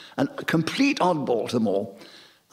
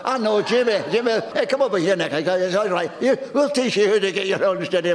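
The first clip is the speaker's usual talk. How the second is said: broad Scottish shipyard voice, raised and lively; Glaswegian accent; 4 semitones higher than usual. Retold or imitated. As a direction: call out like a Clydebank workman. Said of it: "Can you still do the accent?".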